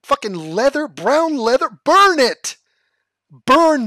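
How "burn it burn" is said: loud, high yell of outrage; mock outraged shout. Ranting at the host's leather jacket, a loud outraged shout. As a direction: bellow it like a zealot.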